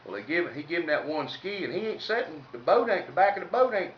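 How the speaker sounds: ordinary talk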